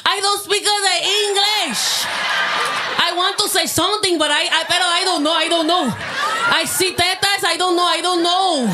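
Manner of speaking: mock Spanish accent. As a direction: play a flustered man with a hint of a Spanish accent.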